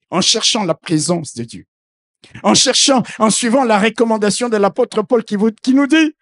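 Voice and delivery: normal talking voice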